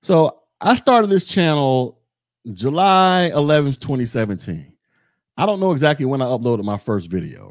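ordinary talk, nothing marked

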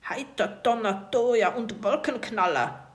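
lauter Piratenfluch, kaum verstellt; leicht angedeuteter polternder Pirat; tiefe Stimme. A narrator's reading. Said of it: "wittert die tiefe Stimme". (Pirat flucht beim Festklemmen, leicht verstellt)

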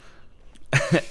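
high breathy giggle; helpless laughing fit; burst of laughter. Real, not acted. Laughs at own story.

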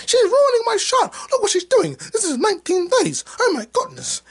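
very high, whiny complaining voice, close to tears; hammed-up diva whine. Jealous actress whining about her rival, high and teary. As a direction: play a spoiled starlet wailing. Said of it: "And she's like".

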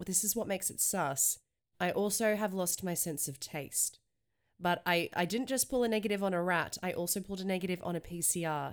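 ordinary delivery

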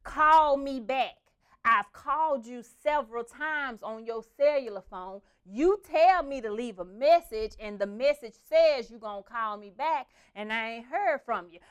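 loud, slow, scolding grandma; scolding grandmother. Retold or imitated. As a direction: drag out her complaint, loud and slow. Grandma's voicemail continued.

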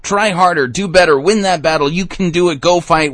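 high, loud rah-rah coach cheer; mock pep talk. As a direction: pump them up like a cheerleader. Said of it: "pure works righteousness pep talk".

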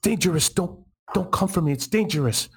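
high, desperate plea to stay away; urgent warning. Acted. Bambi warns Paige not to come for her, in high, frightened urgency. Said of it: "you hear her say".

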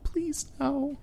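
very high squeaky plea; high pleading squeak. Retold or imitated. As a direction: beg in a tiny high voice. Super Troopers scene pleading reply.

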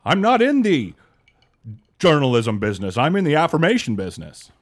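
high-pitched, halting talk-radio impression; badly imitated radio pundit, drifting into Jimmy Stewart; Rush Limbaugh. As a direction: declare it in a put-on high voice with big pauses. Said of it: "I remember one time I heard Rush Limbaugh say".

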